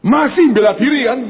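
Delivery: loud, high challenging question; loud mock challenge. Preacher calling out a self-justifying man, loud. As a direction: call him out, loud and high.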